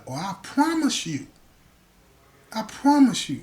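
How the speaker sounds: slow, weighted threat; stern warning. Retold or imitated. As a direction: drag out the promise as a threat. Acts a threat to the man.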